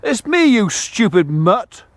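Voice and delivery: loud, high, slow yell to the dog; exasperated shout at dog. Real, not acted. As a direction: drag it out, fed up with the dog. Shouting at dog barking at her.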